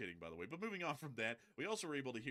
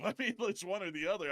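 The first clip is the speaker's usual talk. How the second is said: laughing; 9 semitones higher, 8 dB louder than usual. Real, not acted. Laughing at shoulder pad quip.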